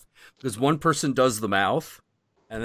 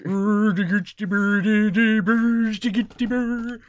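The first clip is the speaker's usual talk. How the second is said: high, loud Muppet chef gibberish; Swedish Chef voice; 12 semitones higher, 4 dB louder than usual. Pretend. Doing the chef for a friend: high loud mock-Swedish babble.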